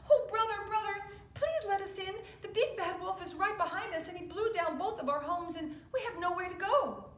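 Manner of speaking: high, loud, breathless begging; panicked pig. Acted. Little pig begging his brother to open up, panicked. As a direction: plead frantically at the door. Pigs fleeing wolf.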